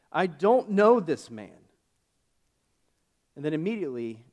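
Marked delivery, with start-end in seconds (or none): 0.1-1.7 louder, higher, slow and forceful; swearing denial. Retold or imitated. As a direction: insist with a sworn oath, dragging each word. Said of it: "swore, swore to them".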